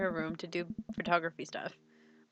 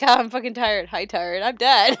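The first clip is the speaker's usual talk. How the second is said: loud goofy dad pun; hammed-up corny dad voice; mock dad joke; 2 semitones higher, 10 dB louder than usual. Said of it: "or it's like".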